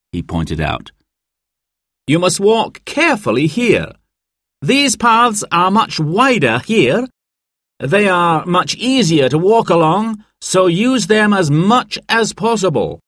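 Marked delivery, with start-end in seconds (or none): 2.1-13.0 high, projected group-briefing voice; mountain guide presenting. A narrator's reading. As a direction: introduce yourself to guests, pitched well up. Guide Dan briefs the guests.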